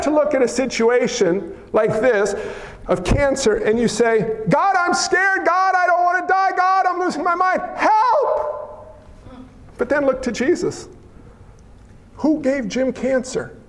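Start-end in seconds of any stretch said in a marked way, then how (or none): 4.5-9.0 pleading, higher, rising to a cry for help; scared prayer. Pretend. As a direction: beg God like a frightened patient. Voicing a scared prayer to God.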